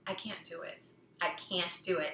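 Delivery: defeated inner voice. Retold or imitated. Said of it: "she had the voice saying".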